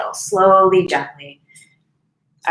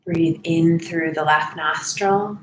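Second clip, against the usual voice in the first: even, softening voice with space to breathe; guided meditation; 2 semitones lower, 4 dB quieter than usual. Real, not acted. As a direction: guide them unhurriedly through each breath.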